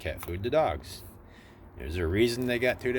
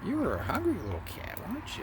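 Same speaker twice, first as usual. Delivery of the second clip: put-on high, sing-song baby talk; 9 semitones higher than usual. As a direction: coo at the hungry kitten. Cooing at the cat.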